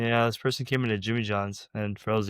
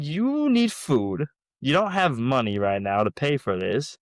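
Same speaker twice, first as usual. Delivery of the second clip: loud, emphatic retold telling-off; hammed-up insisting; acted insistence; 3 semitones higher, 5 dB louder than usual. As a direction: lean hard on the key words, mock-exasperated. Acts out insisting customer take food.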